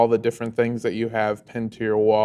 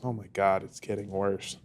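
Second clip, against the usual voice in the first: very low, soft aside to himself; hushed, dropped-voice worry; muttering under his breath; 6 semitones lower, 14 dB quieter than usual. Acted. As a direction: mutter it so the kid can't hear. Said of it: "mutters to himself sort of under his breath".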